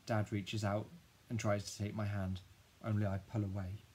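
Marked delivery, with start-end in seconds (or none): none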